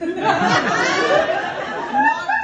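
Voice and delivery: high, loud embarrassed laugh; burst of laughter. Real, not acted. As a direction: laugh out at the memory. Embarrassed laugh at own story.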